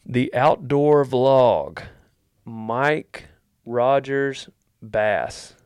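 announcer read. Shown as a demonstration. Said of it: "announcing the next question".

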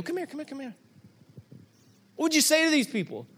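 high, eager coaxing questions; urging her over to speak; bright eager coaxing; excited asking. Retold or imitated. Pastor voices eager question to Cammie.

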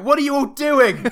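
high, loud, slow mock scolding; imitating angry usher. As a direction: scold them like an annoyed usher. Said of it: "the usher came in and went".

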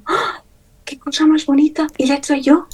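a gasp, then high, thrilled exclamations; amazed delight. Retold or imitated. Gasping in wonder at her own drawing, voice jumping high. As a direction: sees her first finished drawing and gasps with delight.

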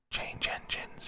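rasped out in a bare, breathless whisper; a voice too hoarse to speak aloud, whispering. A narrator's reading. Hoarse passenger rasping to the carriage, whispered. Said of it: "a hoarse voice spoke next".

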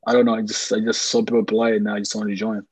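usual speaking voice

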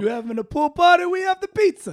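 high, loud Italian-American sales pitch; mock Italian accent. Pool guy pitching party pizzas, loud Italian accent.

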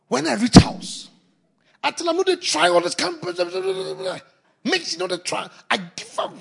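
hammed-up imitation of a wife venting about her husband; ranting wife.

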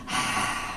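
unvoiced blowing sigh; demo exhale. Shown as a demonstration. Pastor showing the congregation how to exhale, soft sigh. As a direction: blow the breath out softly. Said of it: "Just blow it out".

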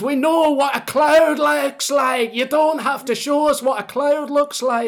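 high, loud Scottish comic outburst; incredulous Scottish stand-up rant; Scottish accent. Acted. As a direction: shout it slowly in exasperated, hammed-up Scottish. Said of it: "I'll do my best Scottish accent".

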